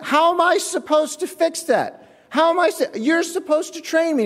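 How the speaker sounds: high, exasperated complaint; mock outrage at the manager; indignant protest. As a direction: push back, outraged. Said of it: "I was like".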